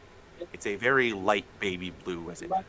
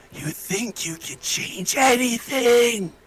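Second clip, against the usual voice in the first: booming dark villain voice, slow and loud; menacing evil entity, drawn out and huge; haunting voice; 6 semitones higher, 7 dB louder, slower than usual. Acted. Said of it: "consume you with a haunting voice piercing your ears".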